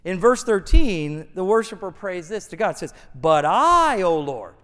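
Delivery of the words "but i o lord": loud "I", then "O Lord" dropping lower; stress on "I". Acted. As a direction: lean hard on "I" for contrast. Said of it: "Note the contrast here".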